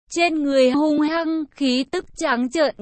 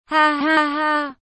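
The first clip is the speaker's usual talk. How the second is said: slow, fierce villain laugh; arrogant villain laugh; 2 semitones higher, slower than usual. A narrator's reading. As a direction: cackle down at the beaten foe. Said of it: "hung hăng".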